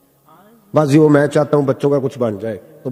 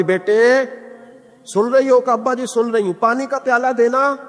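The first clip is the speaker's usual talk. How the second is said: calling out; 7 semitones higher than usual. Retold or imitated. Father calling daughter again.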